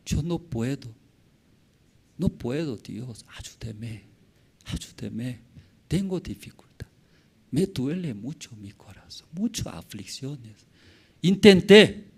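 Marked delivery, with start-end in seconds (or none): 0.1-10.5 soft, halting plea for help; pleading prayer. Acted. Preacher modelling a believer's helpless prayer to God, soft pleading. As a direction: pray quietly, worn down, pausing between phrases.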